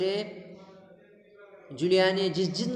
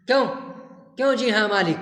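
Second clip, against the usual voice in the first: louder, mocking echo of "जी हाँ मालिक" with "क्यों" snapped; angry echo; 4 semitones higher, 5 dB louder, much faster than usual. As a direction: throw her "जी हाँ मालिक" back at her in a mild mock rage. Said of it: "गुस्से से तुतलाने लगता है".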